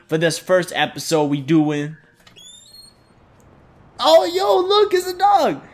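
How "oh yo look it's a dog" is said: high excited squeal of delight; gush, way up high, at spotting a dog. Real, not acted. Spots a dog in the game.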